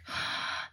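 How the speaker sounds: soft whispered gasp of wonder; awed gasp. Retold or imitated. Watching little kids speak languages, hushed awed gasp. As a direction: gasp quietly in amazement. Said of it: "and I'm like".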